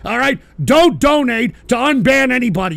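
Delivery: loud, high, emphatic order; angry warning. Real, not acted. Streamer forbidding donations for unbans, loud and angry. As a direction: drive the warning home loudly. Host angrily warns chat.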